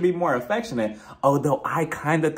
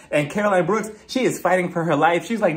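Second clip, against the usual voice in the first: ordinary talk, nothing marked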